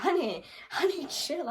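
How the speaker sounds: high, urgent calming voice; pleading with her to calm down; friend calming her. Retold or imitated. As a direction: friend urging her to chill. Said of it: "she's like".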